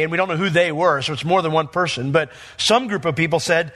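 usual speaking voice

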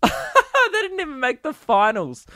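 high voice breaking out of laughter; laughing. Real, not acted. Delighted by his own prank, high laughing. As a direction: laugh your way through it.

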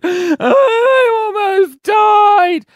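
slow, loud, high crying wail; mock sob. Pulled from the pool, wailing in mock tears.

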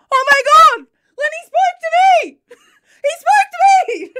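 very high, loud retold squeal; hysterical amazement. Host re-enacts hearing her dog say no, squealing in amazement. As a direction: shriek it in mock-hysterical disbelief.

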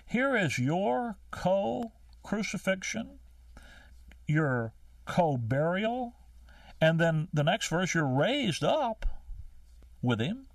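preacher slowing to drive home the point; slow emphatic preaching. Real, not acted. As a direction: stretch each phrase for weight. Climactic preaching build.

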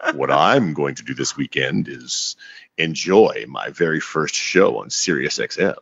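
slow, deliberate announcement, pitched a little low; speaking slowly. Real, not acted. Host buying his co-host time to think, speaking slowly. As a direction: draw the sentence out slowly to stall. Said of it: "I'll speak slowly".

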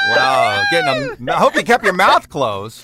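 loud amazed exclaim, then a joke; loud surprised wow. Real, not acted. Host reacts to kids' heads out sunroof.

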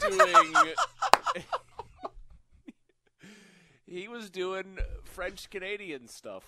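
loud, high laughing voice. Real, not acted. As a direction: talk through real laughter. Cracking up over the played call.

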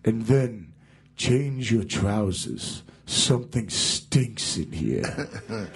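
slow, drawn-out mock [name] order, holding back a laugh; deadpan slow [name] demand; [name] impression. [name] orders his new guitarist to fetch a sandwich, slow mock voice. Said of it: "And he goes".

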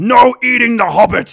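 orc impression. Orc ordering others off the hobbits, loud creature voice. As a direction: forbid it as a bossy orc. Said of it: "And it's like".

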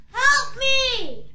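usual speaking voice

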